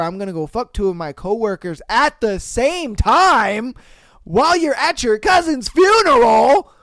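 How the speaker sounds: reading the cheating story in disbelief; outraged rant. Real, not acted. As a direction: get worked up at the betrayal.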